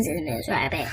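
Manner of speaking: usual speaking voice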